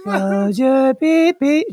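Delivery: high, slow, eerie ghostly mock; creepy ghost voice. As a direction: drag it out like a spooky ghost. Mocking the creepy sleep story voice.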